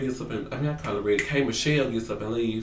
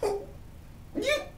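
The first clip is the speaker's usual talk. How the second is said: far higher put-on voice, grudging; comic high-pitched annoyance; mock exasperated squeal; 5 dB quieter than usual. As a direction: squeak out reluctant agreement. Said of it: "I was like".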